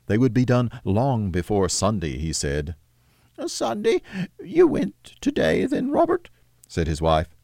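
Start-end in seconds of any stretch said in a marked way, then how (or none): 3.5-6.3 hide the grief behind an excuse; sad mother. Acted. Wife asks.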